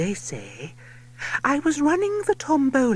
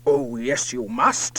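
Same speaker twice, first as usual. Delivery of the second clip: put-on much lower, pompous old lord; low lordship voice; 10 semitones lower than usual. A narrator's reading. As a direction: insist like a boozy old lord. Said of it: "His lordship cries".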